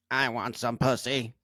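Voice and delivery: slow, buzzing electrolarynx voice; throat voice box. Retold or imitated. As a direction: imitate a robotic voice box. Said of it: "He had one of those throat things".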